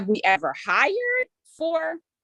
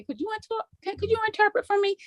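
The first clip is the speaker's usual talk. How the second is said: high, soft, quick quoted question; retold request; 13 semitones higher, 4 dB quieter, much faster than usual. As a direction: lift the voice lightly, imitating your own polite ask. Acting out own request to interpreter.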